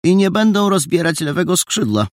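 landlady mentioning rumours over her shoulder; offhand landlady. Acted. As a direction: toss it off as gossip. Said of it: "powiedziała od niechcenia".

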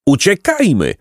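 high-pitched cry, only mildly yelled; yelling. A narrator's reading. Placek yells to flee the ants, high mild yell. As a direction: call out high, urgent. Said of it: "darł się Placek".

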